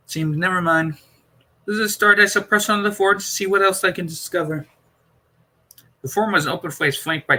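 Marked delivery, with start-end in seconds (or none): 0.1-4.7 a slightly higher, measured Doctor voice; the Doctor explaining calmly, lightly voiced. A narrator's reading. Doctor's voice held in dialogue.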